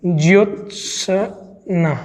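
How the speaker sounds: Jyotsna stretched slowly with half t and half s; slow model word. Shown as a demonstration. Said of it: "इस प्रकार से".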